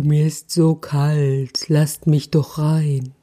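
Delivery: slow, plaintive changed owl voice; whining owl. A narrator's reading. Owl begging to be let in, slow whine. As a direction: plead slowly like a freezing owl. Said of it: "so jämmer-, jammerte".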